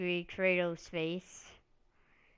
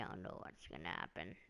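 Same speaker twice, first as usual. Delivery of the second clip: deadpan mumble; 16 dB quieter, much faster than usual. Shown as a demonstration. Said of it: "that was kinda dumb".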